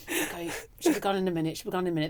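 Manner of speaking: quiet, hidden murmur under the bedding; hushed under covers. Retold or imitated. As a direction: keep it low so nobody hears you. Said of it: "under the covers going".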